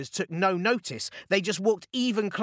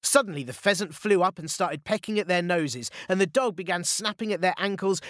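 ordinary delivery

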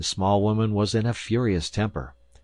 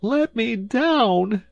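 high, loud, long-drawn wail; 7 dB louder, much slower than usual. A narrator's reading. Wife stuck on the high pedestal, long high loud wail. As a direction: wail it out, stretching every word. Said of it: "she wailed".

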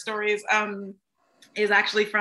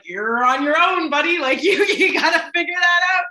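conductor's quip; 5 semitones higher, 7 dB louder than usual. Retold or imitated. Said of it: "watching the conductor just go like".

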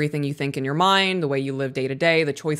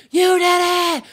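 much higher, louder ecstatic cheer; ecstatic praise; 14 semitones higher, 6 dB louder, slower than usual. Retold or imitated. Mom cheering son who finally calmed down, ecstatic high shout. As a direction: burst out cheering like a thrilled parent. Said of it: "And I lost my mind".

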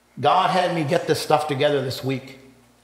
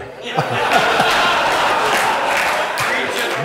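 loud laugh; 7 semitones higher, 4 dB louder than usual. Real, not acted. Joke about steak dinner.

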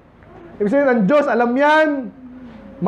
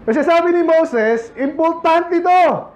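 raised, higher pleading sermon voice; preaching at full force; 3 semitones higher than usual. Acted. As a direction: preach it out, lifting the voice to the crowd. Preaching at full force about Moses. (nangangaral nang buong lakas)

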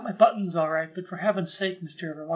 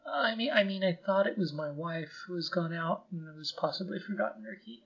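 normal talking voice

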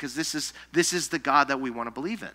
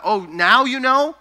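sarcastic retort; 9 semitones higher, 9 dB louder than usual. Real, not acted. Mocking the widow's sudden belief.

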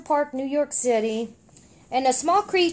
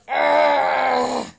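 strained loud grunt; possessed groan; 3 dB louder than usual. Retold or imitated. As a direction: grunt as the stone takes hold. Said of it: "And he goes".

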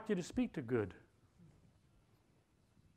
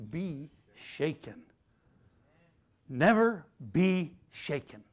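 slow emphasis; 2 semitones lower, slower than usual. Shown as a demonstration. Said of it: "slow emphatic scripture".

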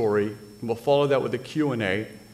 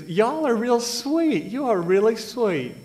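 high, slow Southern belle drawl, much higher; sweet Southern girl accent, pitched way up; 10 semitones higher, 4 dB louder than usual. Retold or imitated. Retelling seventh grade crush Glenda, in a high Southern accent. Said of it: "she had a southern accent".

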